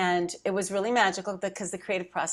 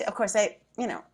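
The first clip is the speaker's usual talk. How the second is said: usual speaking voice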